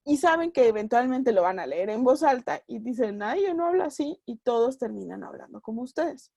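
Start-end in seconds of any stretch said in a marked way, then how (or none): 3.2-4.2 higher, fussy objection; prissy mock protest. Writer reading rough dialogue aloud, prissy and high. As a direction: act offended at the very idea.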